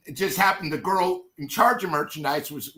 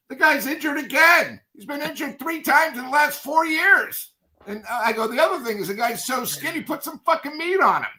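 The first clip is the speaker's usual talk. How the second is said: exasperated rant; 7 semitones higher than usual. Retold or imitated. Ranting about the pitcher.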